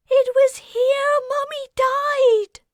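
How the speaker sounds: very high, loud sobbing cry; distraught child. Acted. Mickey crying out in distress, very high child's voice. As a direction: cry out in grief. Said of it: "Mickey cried".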